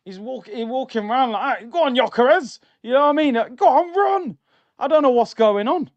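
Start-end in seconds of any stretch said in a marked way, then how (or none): 1.7-2.6 higher, louder call to a player; mock shout. 3.5-4.4 much higher, louder urging shout; mock shout. Shouting at player.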